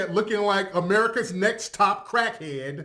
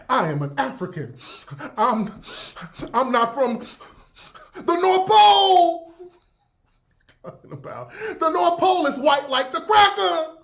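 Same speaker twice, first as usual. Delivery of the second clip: mocking his speech; 5 semitones higher than usual. Mocking his African claims.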